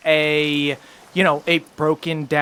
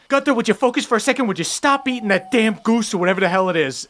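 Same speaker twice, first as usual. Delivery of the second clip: fast, higher nagging mouse voice; annoyed mouse; 5 semitones higher, much faster than usual. Acted. Mouse folk scolding the bear Gunther, fast nagging. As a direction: rattle it off like a fussy little mouse. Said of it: "he goes".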